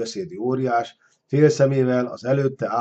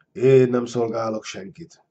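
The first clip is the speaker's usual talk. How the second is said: ordinary delivery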